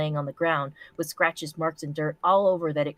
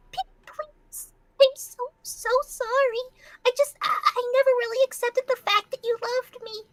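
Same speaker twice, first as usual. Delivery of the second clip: pleading pony voice. Acted. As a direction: plead like a squeaky cartoon pony. Pony begs in reading.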